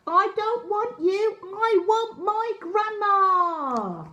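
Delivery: put-on high, slow, pleading child voice; a frightened boy wanting his gran; drawn-out tearful child plea; upset little boy. A narrator's reading. Lost child refusing a stranger.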